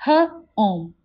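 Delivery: H-ome split into onset and long vowel; segmented. Shown as a demonstration. Teacher sounding out H-ome for the class. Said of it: "Can you say it with me?".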